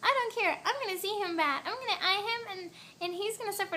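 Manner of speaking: imitating a friend in a high little voice; mock-threaten in her cute, high-pitched voice. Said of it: "The little voice like that".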